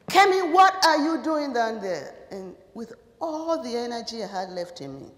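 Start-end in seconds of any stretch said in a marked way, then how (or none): 0.1-2.2 loud, higher call across the room; stern professor calling from the front. Retold or imitated. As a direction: call her name sharply across the hall.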